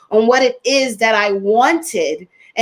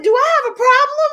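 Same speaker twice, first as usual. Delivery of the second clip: jumps much higher, quick and alarmed; alarmed question; 3 dB louder, faster than usual. Retold or imitated. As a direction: ask yourself in sudden panic, much higher. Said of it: "I said".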